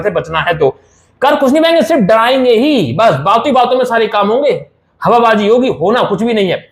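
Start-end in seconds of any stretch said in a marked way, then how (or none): none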